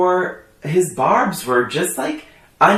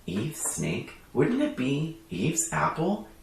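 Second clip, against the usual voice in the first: quiet smug host; 3 semitones lower, 15 dB quieter, slower than usual. Retold or imitated. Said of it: "He's like".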